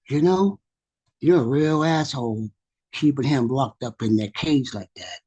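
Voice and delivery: ordinary delivery, nothing marked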